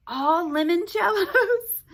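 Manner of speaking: high, loud laughing question. Acted. Laugh heard.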